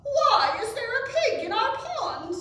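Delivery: high, loud, incredulous question; astonished frogs. A narrator's reading. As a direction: ask in loud disbelief. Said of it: "said the frogs".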